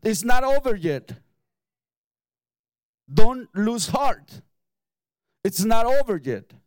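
loud, ringing sermon exhortation; rally the congregation, hammering each phrase; preaching at full force. Acted.

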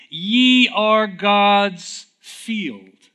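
very slow, high and loud proclamation of "Ye are God's field"; full preaching force; proclaiming. Acted. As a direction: ring it out to the congregation. Proclaiming verse.

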